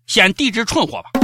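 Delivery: ordinary delivery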